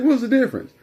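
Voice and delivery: high-pitched mock demand; demanding dad. Dad demanding son explain the difference, demanding. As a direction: snap the question out high, as Dad.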